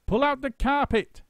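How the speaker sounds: high loud monkey order; shout. A narrator's reading. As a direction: yell the order across the room. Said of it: "shouted Mugglewump".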